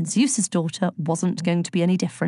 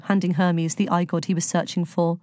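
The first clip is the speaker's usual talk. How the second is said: plain reading voice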